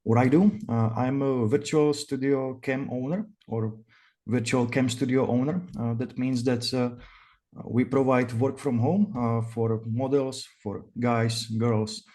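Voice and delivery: Czech-accented English, relaxed interview pace. Real, not acted. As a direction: speak English like a native Czech.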